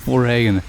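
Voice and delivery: "For Reagan" for foreign, with a hard G; every letter pronounced. Shown as a demonstration. Gamer mocking too many letters being pronounced, fo-RAY-gen pronunciation. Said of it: "Too much letters being pronounced".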